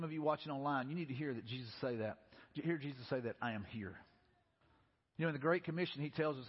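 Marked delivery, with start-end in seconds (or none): 3.4-4.0 very low, soft comfort; quiet reassurance. Acted. Jesus speaking to struggling listeners, quiet reassurance. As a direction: let it land gently and low.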